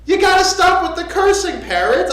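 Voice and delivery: high, loud acted scolding; upset owner. Upset man tells his parrot to stop cursing, scolding. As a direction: play an exasperated parrot owner laying down the law. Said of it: "The guy gets upset".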